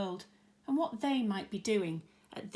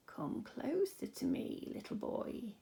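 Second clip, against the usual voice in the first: put-on slow, soft whisper of an old man; creepy old man, whispered; slow creaky old man; 5 semitones higher, 6 dB quieter than usual. A narrator's reading. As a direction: lure the boy in with a creaky whisper. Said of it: "very slow and creaky".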